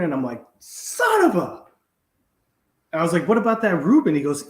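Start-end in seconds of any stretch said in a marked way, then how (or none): 0.6-1.6 high, slow, strained cry of frustration; mock outburst. Retelling his lunch order, a high frustrated cry. 3.3-4.2 higher and louder, keen for the Reuben; eager question. Retold or imitated. Asking the waiter about the Reuben, eagerly raised.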